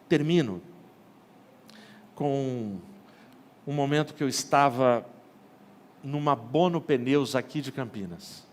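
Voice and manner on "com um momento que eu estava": usual speaking voice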